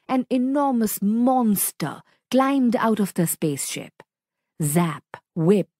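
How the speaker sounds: ordinary delivery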